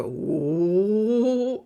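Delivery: drawn-out rising "whoo" sound; amping-up whoosh. Retold or imitated. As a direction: imitate a body revving up. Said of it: "like amping up".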